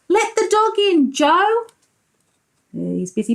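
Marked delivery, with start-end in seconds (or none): 0.1-1.7 higher called request to Joe; dad calling. Acted. Dad on the ladder calls to Joe, higher calling voice. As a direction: call it out like a busy dad. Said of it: "the dad's saying".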